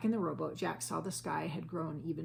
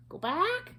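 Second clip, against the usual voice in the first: much higher parrot voice; parrot squawk; 14 semitones higher, 7 dB louder than usual. A narrator's reading. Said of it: "squawked Polly".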